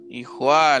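a loud mock whip crack, juá; whip crack sound. Retelling the monster's whip strike, a loud mock crack.

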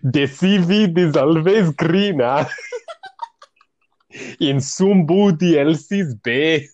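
slow, hammed-up Jamaican accent. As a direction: sing it slowly in a Jamaican accent. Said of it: "put on my Jamaican accent".

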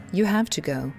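ordinary delivery

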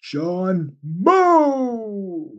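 high announcer boom introducing a fighter; wrestling announcer. Pretend. Host imagining John Moe as a wrestler, ring announcer voice. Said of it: "Sounds like he's a wrestler".

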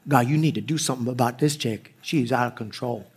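low, hushed grumbling prayer; muttered complaint to God. Retold or imitated. Husband privately praying about his wife, quiet complaint.

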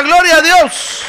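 loud, high and slow; call to repeat. Shown as a demonstration. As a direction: lead the congregation in the response. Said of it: "A ver, diga".

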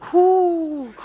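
high, loud, drawn-out whoop of alarm; startled "hu". Acted. Believer imagines being told to command a healing, startled whoop. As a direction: let out a long, high "hoo" at the daunting thought.

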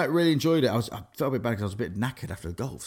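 normal talking voice

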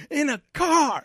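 high, loud blurted answer; exasperated shout. Retold or imitated. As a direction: snap it out in frustration. Shouting reply.

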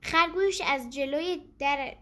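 ordinary delivery

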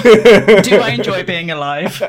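loud, slow, laughing through it; laughing at her own joke; laughing disbelief, drawn out. Real, not acted. Laughing at the steak bake joke.

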